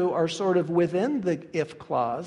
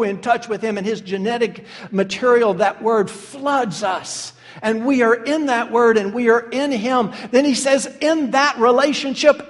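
building pulpit fervor, louder and higher; preaching; 4 semitones higher, 4 dB louder than usual. Acted. Pastor urging brothers and sisters toward prayer, rising preaching.

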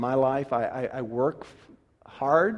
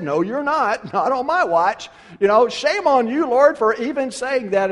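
louder, higher indignant no; mock Peter protest; 8 semitones higher, 8 dB louder than usual. Peter protesting Jesus's plan, mock indignant. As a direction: scold the Lord like a know-all. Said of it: "and you said".

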